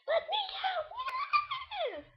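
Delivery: high, slow, laughing shout to be let out; mock cry for help. Child in a game cage yelling to be let out, laughing shout. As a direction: wail it like a prisoner, half laughing. Kid playing trapped in cage.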